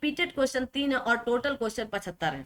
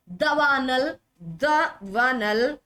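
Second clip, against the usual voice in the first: slow syllables; much slower than usual. Shown as a demonstration. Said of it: "सही उत्तर दवानल होता है".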